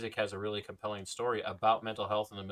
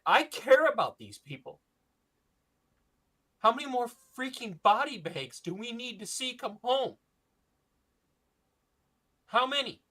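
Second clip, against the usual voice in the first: higher, louder, voice full of feeling; impassioned, near-tearful plea; emotional; 11 semitones higher, 6 dB louder than usual. Real, not acted. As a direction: plead for the fallen soldiers. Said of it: "I'm gonna get emotional".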